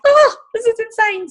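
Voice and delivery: very high, loud delighted exclamation; thrilled squeal. Retold or imitated. Hearing he wants to grow the business, a high thrilled squeal. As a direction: squeal with disbelieving joy. Thrilled reaction to his enthusiasm.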